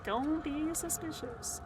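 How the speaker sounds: slow, sing-song innocent tone. Pretend. Sneaking past enemies, slow sing-song mock innocence. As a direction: stretch it out like a kid pretending innocence.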